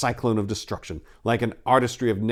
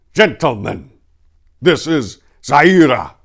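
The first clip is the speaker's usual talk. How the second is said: loud, high showman's unveiling, slowing at the name; grand presentation; 3 semitones higher, 9 dB louder than usual. Acted. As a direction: present the prize like a ringmaster. Falcon presents his prize.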